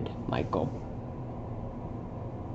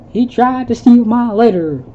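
put-on high, loud whiny kid voice; upset child's complaint; 8 semitones higher, 9 dB louder than usual. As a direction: wail it as a hurt child. Crying kid after scream.

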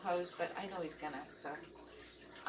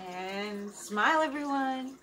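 bright sign-off; 6 semitones higher, 7 dB louder, slower than usual. Real, not acted. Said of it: "cheerful sign-off".

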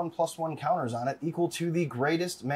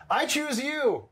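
mock battle cry; 11 semitones higher, slower than usual. Dramatic card cast callout.

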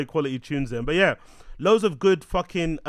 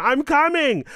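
put-on high, loud German accent; 13 semitones higher, 7 dB louder than usual. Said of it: "In my German accent".

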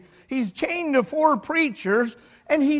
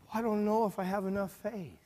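small, soft, mildly worried voice; 3 semitones lower, 9 dB quieter, faster than usual. Retold or imitated. Believer doubting while asking God, quiet worried admission. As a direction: lightly act out a hesitant doubter. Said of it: "Sometimes we get worried and say".

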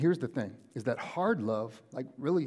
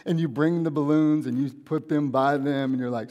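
ordinary talk, nothing marked

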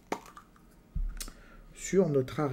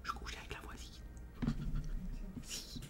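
whisper; 5 semitones higher, 17 dB quieter than usual. Pretend. Secret confession aside.

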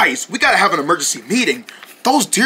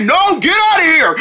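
shouting no; 8 semitones higher, 3 dB louder than usual. Acted. Refusing the bug.

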